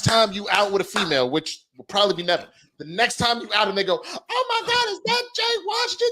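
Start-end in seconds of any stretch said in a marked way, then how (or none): 4.1-6.1 very high-pitched gushing; excited fan. Retold or imitated. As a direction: squeal like a starstruck fan.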